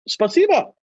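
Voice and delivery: high, tentative "spasibo"; shy Russian thanks. Retold or imitated. Visitor thanking the guard for the orange, tentative. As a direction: lift "spasibo" up high, unsure and grateful. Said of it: "I'm like".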